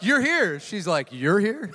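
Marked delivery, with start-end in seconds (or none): 0.0-0.6 high, louder exclamation of surprise; retold surprise. Ex-husband meets ex-wife at the pearly gates, surprised. 1.1-1.7 higher-pitched incredulous echo; echoed surprise. Retold or imitated. Ex-wife answers her ex-husband at the pearly gates, incredulous.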